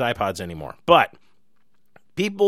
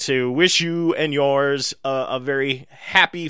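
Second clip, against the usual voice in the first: usual speaking voice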